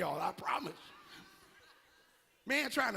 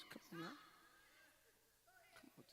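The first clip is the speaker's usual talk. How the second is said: normal talking voice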